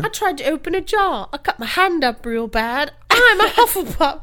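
put-on high, bright girl voice from a sketch; man doing a woman's voice. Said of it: "that girl from that sketch that's like".

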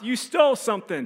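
high-pitched accusing shout; men's yell. Retold or imitated. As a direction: imitate a guard calling out an accusation. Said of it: "these men's voice yell from behind us".

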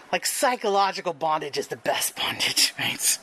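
breathy whisper, sharing a secret; whispered aside. Real, not acted. Teller whispers a naughty confession to the audience. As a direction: lean in and whisper it to the crowd.

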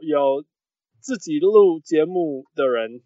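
ordinary talk

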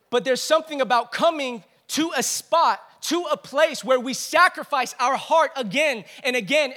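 rally the community together; rising preaching. Real, not acted. Preaching builds in intensity.

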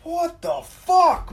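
slow, high, loud what the fuck; drawn-out disbelief. Retold or imitated. Driver stuck on the ramp, slow high disbelieving curse. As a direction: stretch it out in disbelief. Stuck truck, retold outburst.

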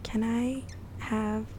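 slow, small unvoiced whisper; meek customer whispering an order; timid whisper. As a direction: shrink into a shy order. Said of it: "You walk into the Jamaican restaurant like".

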